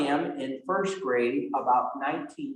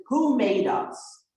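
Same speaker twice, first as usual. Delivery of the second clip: slow high catechism question; slow drawn-out quiz question; 6 semitones higher, slower than usual. Shown as a demonstration. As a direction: ask it slowly like a teacher quizzing a child. Catechism question posed to child.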